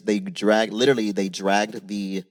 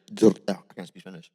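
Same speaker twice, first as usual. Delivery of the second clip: talking around a wad of gum; tongue stuck on the gum; chewing gum; faster than usual. Real, not acted. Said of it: "There's gum in my mouth".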